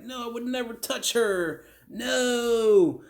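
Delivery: high, drawn-out whiny denial; hammed-up tantrum in a high put-on voice; mock big fit. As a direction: imitate an over-the-top protest, slowly. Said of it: "he's throwing a big fit, like".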